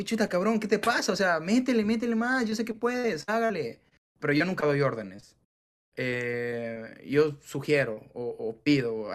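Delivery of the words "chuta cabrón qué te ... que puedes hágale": egging a friend on to push harder; pep talk push. Retold or imitated. Pushing a team member on.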